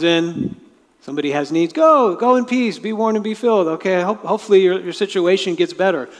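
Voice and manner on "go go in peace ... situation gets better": high, bright send-off; pep them on their way; upbeat see-you goodbye; cheery encouragement. Acted. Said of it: "like an encouragement".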